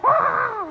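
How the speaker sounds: high mock cry of frustration; exasperated high yelp. Speaker mimics her own fed-up outburst, exasperated cry.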